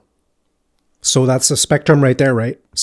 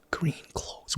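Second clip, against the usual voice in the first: whispering; 18 dB quieter than usual. Real, not acted. Whispering guess to himself.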